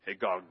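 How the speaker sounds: Higongunzon said low and grumbly; grumbling Greek word. Shown as a demonstration. Congregation repeating the Greek word together, grumbling. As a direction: mutter Higongunzon like a complaint. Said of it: "say it in a grumbling way".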